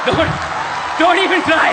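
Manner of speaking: high, loud, mock-stern yell; don't, repeated as a big comic shout; shouted warning. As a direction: bark the warning right at her. Roaster warns Alia, then repeats louder.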